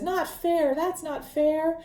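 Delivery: mildly acted indignant objection; mock protest. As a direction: protest it as a retold Gollum, voice lifted. Gollum's protest at the riddle, acted.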